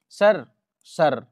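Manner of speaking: loud, drawn-out slow call of sir. Acted. Student calling out to the teacher, slow loud call. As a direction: stretch out sir sir to get his attention.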